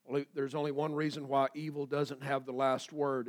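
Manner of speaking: normal talking voice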